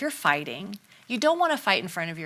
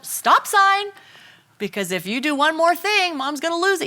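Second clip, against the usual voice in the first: put-on high, loud warning to a child; loud parent warning cry to a child; 8 semitones higher, 5 dB louder than usual. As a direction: call it out like a stop sign to your kid. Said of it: "you say to your child".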